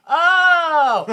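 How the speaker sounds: high, loud drawn-out oh; big realization. Real, not acted. Co-host catches on to the joke, loud realization. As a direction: let it burst out as it dawns. Sudden reaction to the pun.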